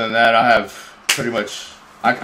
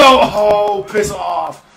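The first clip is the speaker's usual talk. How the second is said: angry shout; 8 semitones higher, 4 dB louder, much slower than usual. Real, not acted. Gamer yelling at opponent.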